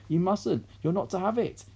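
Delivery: high, urgent pleading; boy's anxious plea. A narrator's reading. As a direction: warn her off. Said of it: "George cried out".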